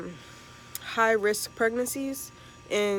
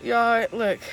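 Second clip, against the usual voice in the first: panting after climbing stairs; breathless from running up steps; out of breath; 3 semitones higher than usual. Real, not acted. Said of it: "I'm trying to catch my breath".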